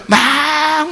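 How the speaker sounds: high, long-held vowel; drawn-out mock tantrum wail; imitated tantrum. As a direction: stretch it out high like a child kicking up a fuss.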